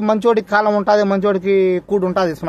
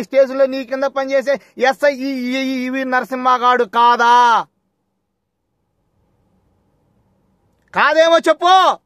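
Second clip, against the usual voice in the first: angry challenge; 4 semitones higher, slower than usual. Real, not acted. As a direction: throw the accusation at him slowly. Confronting an official over a case.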